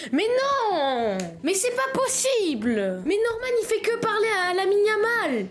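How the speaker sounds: high, drawn-out whine of protest; mock dismay. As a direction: wail it like a playful tragedy. Mock dismay at game characters. (fausse indignation plaintive, aiguë)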